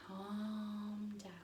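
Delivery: soft, slow and soothing; slow calm down. Shown as a demonstration. Teacher showing parents a soft, slow calm down. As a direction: settle an overexcited child gently. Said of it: "doing it slowly".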